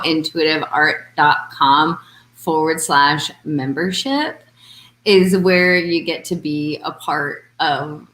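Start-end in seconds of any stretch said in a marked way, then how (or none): 0.0-4.4 slow, careful web address read; slow plug. Shown as a demonstration. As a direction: spell out the address slowly for listeners. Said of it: "So let's just plug right now".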